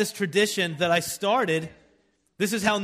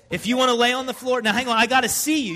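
yelling across the room; 7 semitones higher, 6 dB louder than usual. Real, not acted. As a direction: raise your voice over the group. Directing kids on the floor.

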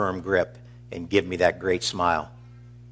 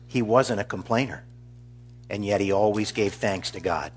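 ordinary talk, nothing marked

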